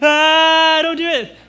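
panicked family warning shout; alarmed shout. Retold or imitated. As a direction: yell to stop a toddler eating off the floor. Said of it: "and we're like".

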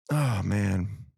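low, sighed-out words; little sigh. Acted. Partner in the corner, making a little sigh. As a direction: let the words sink on a sigh. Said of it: "little sigh in the corner going".

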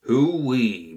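a bright, high whoop of glee; amused hoo-wee. A narrator's reading. Bert hooting at the fancy captive. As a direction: whoop like you can't believe your luck. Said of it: "Bert began to laugh".

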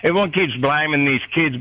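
usual speaking voice

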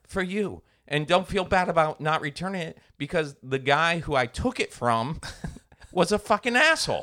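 God reassuring him to keep the cash, hammed up; mock God. God telling him to keep the wallet money, mock reassuring.

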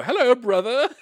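high, loud proper hello; posh greeting. Shown as a demonstration. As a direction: greet him far too properly. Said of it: "I would go".

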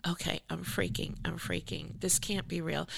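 hushed, stunned "I'm freaking"; softly panicking to herself; retold freaking out. Artist alone at night reading the email, hushed panic. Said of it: "And I'm like".

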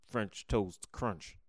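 very slow, deliberate cereal name; careful enunciation. Real, not acted. Naming a disliked cereal while concentrating, careful enunciation. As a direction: concentrate hard on every word. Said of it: "I have to concentrate when I say it".

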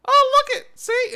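put-on high, loud gleeful kid; excited little boy. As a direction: squeal like a delighted child. Child voiced in story.

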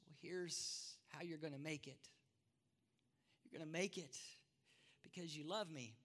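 soft, tender answer; Jesus reassuring. Acted. Jesus softly tells Thomas how he will make it, tender. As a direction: lay it down gently, word by word. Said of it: "And Jesus says".

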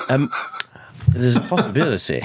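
usual speaking voice

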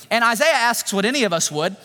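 usual speaking voice

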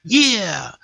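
high, falling mock-girl "yeah"; background girls cheering. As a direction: imitate the background girls, pitched high and drawn down. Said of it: "the girls in the background going".

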